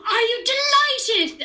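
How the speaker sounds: high, loud, stretched-out mock delight; gasp, then gush like a thrilled parent; gushing parent imitation. Imitates parents hearing big news.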